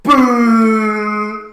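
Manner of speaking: loud, high drawn-out boo; crowd booing. Retold or imitated. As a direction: boo like a whole stadium. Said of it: "and everyone's like".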